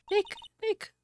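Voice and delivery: mock panicked call; alarmed shout. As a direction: cry out his name in alarm. Calling to fallen friend.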